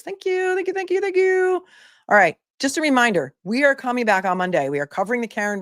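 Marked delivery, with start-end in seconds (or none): 0.0-1.6 high, sung thank-yous; singsong thanks. Real, not acted. Thanking chat repeatedly.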